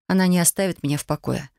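ordinary delivery, nothing marked

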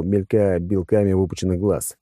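usual speaking voice

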